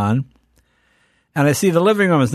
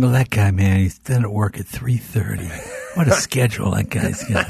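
imitating himself; 4 semitones lower than usual. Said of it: "I remember thinking".

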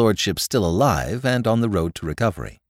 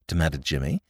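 usual speaking voice

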